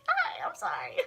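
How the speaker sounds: tiny high-pitched, whispery squeak; sheepish apology. Pretend. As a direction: squeak out a playful little apology. Sheepish apology to him through camera.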